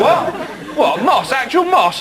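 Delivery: high incredulous what; can't believe it's actual moss; disbelief. Acted. Customer hearing the genie suggest moss, incredulous.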